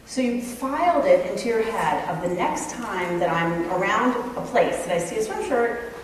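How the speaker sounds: ordinary delivery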